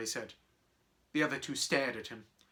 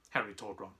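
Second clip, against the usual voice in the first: ordinary delivery, nothing marked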